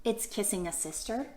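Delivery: lilting read-aloud for little listeners; reading to children. Acted. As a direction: read each picture-book line gently to the kids. Reading picture book to kids.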